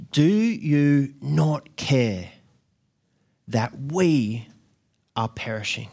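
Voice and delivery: drawn-out, heavy disbelief, slowly; slow pleading question. Retold or imitated. Disciples to sleeping Jesus, slow stunned plea. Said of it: "they would have been shouting and screaming".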